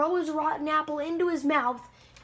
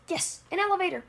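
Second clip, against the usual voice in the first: triumphant little yell, pitched up; mild yell; 6 semitones higher, faster than usual. Acted. Crazy Apple runs into an elevator, mild yell. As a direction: call it out higher without real volume. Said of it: "runs into an elevator and yells".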